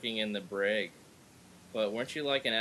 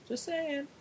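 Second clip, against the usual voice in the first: much higher, teasing "just saying"; squeaky mock "just saying"; 4 dB quieter than usual. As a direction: echo it back in a silly high voice. Mock repeat of the tag line.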